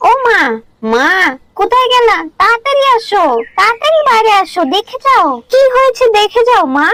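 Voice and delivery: put-on child urgently calling her mother, very high; high-pitched, insistent shouts for Ma to come; excited calling out. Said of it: "সকাল সকাল এত চেঁচাচ্ছিস কেন". (উত্তেজিত গলায় মাকে চেঁচিয়ে ডাকা)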